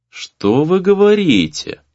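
ordinary delivery, nothing marked

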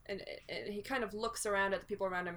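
ordinary talk

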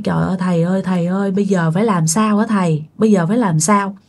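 low-pitched, understated retold plea; pleading. Mother begs the master for help, mildly pleading. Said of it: "mới năn nỉ ông thầy quá trời".

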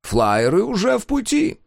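high gloating announcement; gleeful villain declaring. A narrator's reading.

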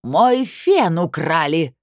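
slow, loud, drawn-out cry; outraged wail. A narrator's reading. Delegate announcing her hairdryer was stolen, outraged loud wail. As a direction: wail every word as a grave outrage. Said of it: "завопила Аврора Илларионовна".

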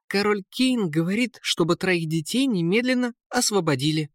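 high-pitched royal command; prince's order. A narrator's reading. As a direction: announce it firmly in the high prince voice. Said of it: "приказал принц". (приказ высоким голосом принца)

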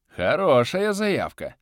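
slow, amused dark lord; smirking. A narrator's reading. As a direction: smirk through the words. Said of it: "усмехнулся бывший шаман".

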